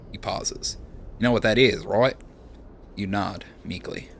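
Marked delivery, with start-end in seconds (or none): 1.2-2.1 put-on light Australian accent, casual; lightly voiced Australian bloke; Aussie accent. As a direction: give him an easy Aussie twang. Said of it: "decided that Seraphin is Aussie".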